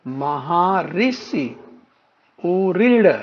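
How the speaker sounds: "महर्षि", "ऊ ऋण" slowly, vowels held long; slow teaching pronunciation. Shown as a demonstration. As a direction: draw out each syllable of "महर्षि" and "ऊ ऋण". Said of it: "क्या समान ध्वनि में आ रहा है".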